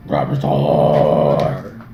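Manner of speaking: drawn-out deep Rarazor; very low slow voice. Pretend. Player echoes the name in a voice.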